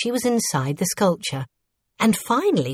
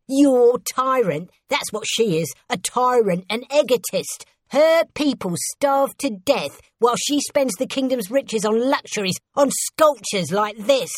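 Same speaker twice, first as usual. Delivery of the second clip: higher, indignant denunciation; rebel's bitter, pitched-up tirade; disgusted rant; 6 semitones higher than usual. A narrator's reading. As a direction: denounce the ruler with scornful outrage. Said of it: "said the rebel in disgust".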